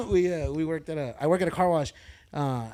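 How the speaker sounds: normal talking voice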